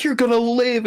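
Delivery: high-pitched urgent promise; Deadpool. Retold or imitated. Deadpool to dying Nice Pool, high acted insistence. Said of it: "And then Deadpool's like".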